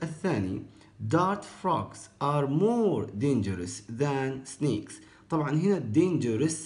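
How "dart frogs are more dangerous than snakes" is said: slow read. Shown as a demonstration. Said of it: "teacher reads example sentence".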